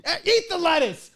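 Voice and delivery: high, loud, slow outburst; mock angry yell. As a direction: yell it in frustration. Mock angry homeless eater.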